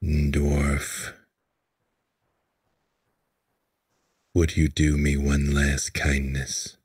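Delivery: low, grave lord's voice. Acted. Grieving lord asking the dwarf a favour, low and grave. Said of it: "And he says".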